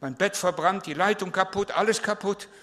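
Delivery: high, loud and urgent retelling of the night; excited teenager. Retold or imitated. Teen telling the pastor about the lightning, high excited voice. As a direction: blurt out the lightning story, shaken and eager. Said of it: "Und er hat mich bewahrt".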